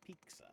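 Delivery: "pizza" said as PEEK-suh; a K replacing the T in "pizza"; imitated pronunciation. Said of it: "she says pizza".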